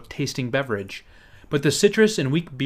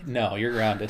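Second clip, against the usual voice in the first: a low, soft mock parent scolding; stern parent; 4 semitones lower, 6 dB quieter, slower than usual. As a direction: play the strict parent saying no. Parent voiced in retold story.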